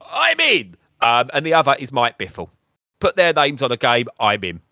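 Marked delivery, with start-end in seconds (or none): 0.1-0.7 high-pitched, loud burst of eagerness; excited reaction. Retold or imitated. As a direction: jump in as an instantly sold fan. Acts out instant reaction to teaser.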